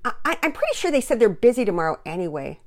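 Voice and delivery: put-on high, urgent child's plea; loud pleading with mother in a young voice. A narrator's reading. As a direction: stretch the dismay, then stammer an excuse. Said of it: "she began to plead with Mama Peters".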